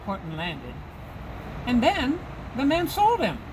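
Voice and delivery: ordinary delivery, nothing marked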